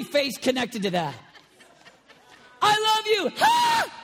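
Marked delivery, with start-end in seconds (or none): 2.6-4.0 loud, high, beaming; delighted God voice. Acted. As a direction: shout it with joy. Preacher voicing God's delight.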